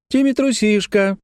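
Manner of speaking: put-on high, taunting child's cry; girl calling out. A narrator's reading. Sister calls brother a coward, high teasing cry. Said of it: "крикнула Лекси".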